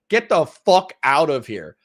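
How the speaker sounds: normal talking voice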